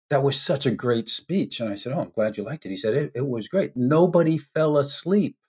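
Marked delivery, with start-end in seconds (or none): none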